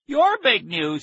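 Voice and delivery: high, loud indignant question; outraged cry. A narrator's reading. Boy cuts off his friend's big news, indignant cry. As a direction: throw it back, shrieking with indignation. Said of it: "he cried".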